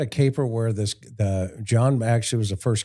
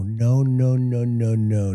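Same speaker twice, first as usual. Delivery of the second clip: ordinary talk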